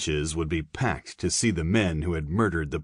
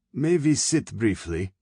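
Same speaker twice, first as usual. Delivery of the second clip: higher, lightly German colonel asking politely; a plump German colonel, out of breath, asking to rest; Fritsch voice, German accent; 5 semitones higher than usual. A narrator's reading. German colonel asks the guards to sit, light German accent.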